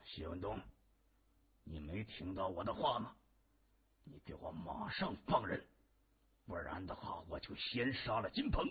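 quiet, seething villain; gritted-teeth threat. A narrator's reading. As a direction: press the threat harder without raising volume. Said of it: "他咬牙切齿地说". (咬牙切齿地压低声音威胁)

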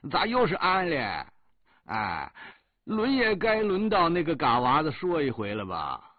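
mock the squad leader's Henan accent, loud and high; mimicked squad leader's accent. Soldier answers squad leader in his own accent, mocking Henan twang. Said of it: "我学着班长的口音回答他说".

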